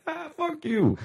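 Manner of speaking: squeaky high mock brush-off; cartoonish high-pitched fuck you; dismissive retort. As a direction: toss it off in a silly high voice. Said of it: "I'm like".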